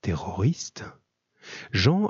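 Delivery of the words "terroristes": whisper. Acted. Frightened boy echoes in dark.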